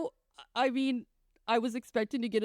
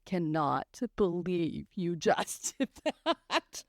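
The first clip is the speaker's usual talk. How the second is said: disbelieving son; 5 semitones lower, much slower than usual. Retold or imitated. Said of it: "David's like".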